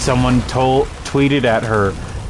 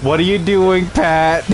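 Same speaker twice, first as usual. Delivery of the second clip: higher, calling out in mock exasperation; exasperated girlfriend; 6 semitones higher, slower than usual. Girlfriend phoning during the stream, in higher mock exasperation. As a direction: call his name like a scolding partner. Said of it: "and she's like".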